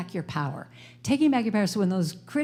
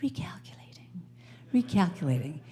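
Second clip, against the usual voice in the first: soft whispered British recalculating; lovely British accent; 2 semitones lower, 5 dB quieter than usual. Retold or imitated. Speaker shows a British GPS voice, soft whispered British accent. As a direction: breathe it like a kind British satnav. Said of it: "in a lovely British accent".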